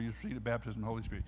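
ordinary talk

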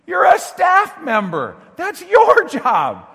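high, loud, slow scolding shout; retold rebuke shout. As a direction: bellow it at him like a boss who's fed up. Rebuking the complaining staff member.

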